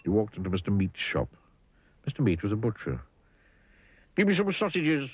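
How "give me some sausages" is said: high-pitched grumpy demand; bad-tempered storybook customer; snapped. A narrator's reading. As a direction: snap out the order crossly, up high. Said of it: "snapped Mister Grumpy".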